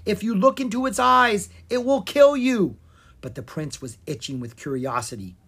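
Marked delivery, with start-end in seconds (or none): 0.0-2.8 high, loud, urgent warning; warning shout. A narrator's reading. Bard warns the prince about the monster, shouting. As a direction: shout it as a matter of life and death. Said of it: "Will shouted".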